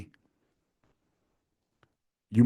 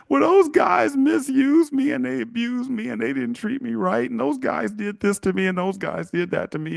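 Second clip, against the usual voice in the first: high-pitched whiny imitation, easing down as it runs; hammed-up listing of grievances in her voice; mock tearful victim; 13 semitones higher than usual. Woman tells boyfriend about her exes, playing the victim. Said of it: "she's tearing up".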